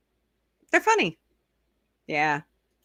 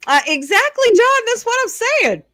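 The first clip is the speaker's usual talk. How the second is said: much higher and louder, bursting with agreement; excited agreement; 6 dB louder than usual. Real, not acted. Host eagerly agreeing with chatter John, high and loud. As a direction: jump in, thrilled someone gets it.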